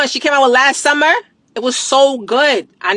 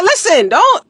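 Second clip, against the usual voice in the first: higher, pleading don't; warning mom; 8 semitones higher, slower than usual. Retold or imitated. Telling mom not to finish her jars, pitched-up warning. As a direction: stop mom finishing the jar. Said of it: "I said".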